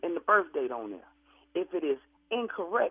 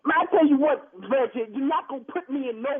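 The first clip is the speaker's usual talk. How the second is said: angry outburst; 5 semitones higher, 3 dB louder than usual. Acted. Funeral director finally yells back at the customer, angry. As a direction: burst out higher and louder. Funeral man loses his temper.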